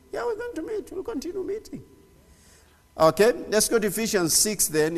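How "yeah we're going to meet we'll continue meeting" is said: playful high answer. Pretend.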